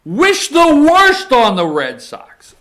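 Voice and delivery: loud, high, heated loathing; emotional outburst. Real, not acted. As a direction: build to a loud, high-pitched curse. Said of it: "I knew I would get emotional".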